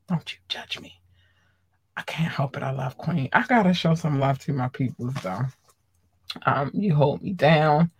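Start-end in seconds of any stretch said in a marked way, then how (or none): 0.1-1.0 whispered teasing plea; playful whisper. Real, not acted. Host teasing viewers not to judge her, whispering. 2.0-3.3 hushed fan confession; whispered confession. Real, not acted. Host confessing her love of Queen in a whisper.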